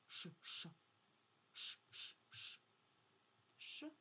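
"Ш" as a short voiceless hush, repeated; isolated sound Ш. Shown as a demonstration. Teacher isolating the next sound for children, short separate "Ш". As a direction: sound out "Ш" alone in short bursts. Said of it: "Далі Ш".

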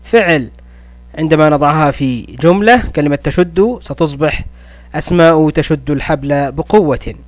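ordinary talk

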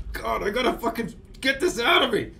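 high, frustrated outburst; real exasperation rising toward a scream; about to scream. Real, not acted. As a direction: burst out with pent-up frustration. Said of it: "I'm gonna scream".

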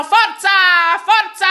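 high, loud urging shouts; cheering crowd. A narrator's reading. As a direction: cheer the swimmer on. (incitamento acuto e forte)

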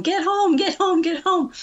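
retold scream. Wife on the phone begging husband to come home, high panicky mock scream. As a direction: squeal it like you just saw a huge spider. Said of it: "I'm screaming into the phone".

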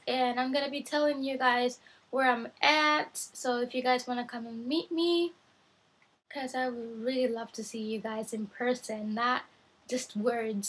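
normal talking voice

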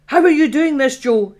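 high, loud, astonished demand; loud shocked question to Joe; startled exclamation. Acted. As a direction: blurt it out in shock. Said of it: "she exclaimed".

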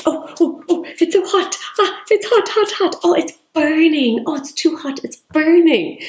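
high, hammed-up yelps of fake heat; mock alarm at a too hot wheat pack; pretend too hot. Shown as a demonstration. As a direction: overplay burning your fingers for a toddler. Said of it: "pretend it was too hot and say".

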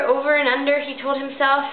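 ordinary delivery, nothing marked

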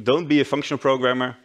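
ordinary talk

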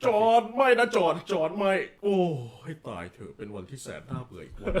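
hammed-up cartoon cat wailing, then sagging into boredom; Garfield. Shown as a demonstration. Voice actor demos his dream role on the hosts' request, hammed-up cartoon cat. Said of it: "ผมอยากพากย์กาฟิลด์อะ".

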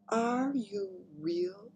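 very slow, high, drawn-out wonder; awed question. A narrator's reading. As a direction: ask as if doubting your eyes. Awed Queen questions Sophie.

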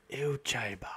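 unvoiced hushed aside; whisper. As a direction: whisper it secretly.

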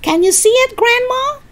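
high excited call, rising at the end; shouting. Acted. George asks Grandma if she sees, high shout. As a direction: call eagerly up to the roof. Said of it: "George shouted".

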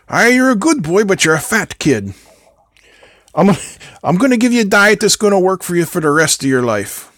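badly faked Irish accent; fake Irish accent. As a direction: imitate an Irish neighbour with a butchered accent. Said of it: "I'm gonna butcher your, your beautiful Irish accent".

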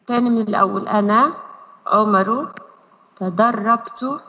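put-on slow syllable-by-syllable reading for children; slow model reading. Shown as a demonstration. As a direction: read each word slowly so the kids can repeat. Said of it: "اقرأوا معايا وقولوا معايا". (قراءة بطيئة واضحة للأطفال)

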